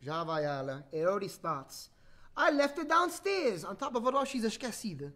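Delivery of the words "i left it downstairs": put-on high, slow child's whine; complaining little sister. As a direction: drag out a little girl's complaint. Story sister keeps complaining.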